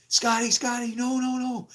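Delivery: high, urgent pleading; retold panicked plea. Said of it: "And I went".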